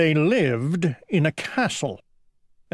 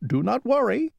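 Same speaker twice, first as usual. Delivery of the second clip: high-voiced young prince; reassuring prince; 10 semitones higher, faster than usual. A narrator's reading. Young prince to lost old king, high reassuring voice. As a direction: reassure in a much higher voice.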